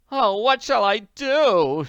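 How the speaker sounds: high, loud, slow wail. Acted. Willoughby loses inheritance, wailing. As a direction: wail in despair. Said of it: "she wailed".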